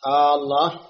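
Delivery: آه الله with the opening hamza stretched long like a question; wrong long hamza on الله; drawn-out hamza. Shown as a demonstration. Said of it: "لا تمد الهمزة".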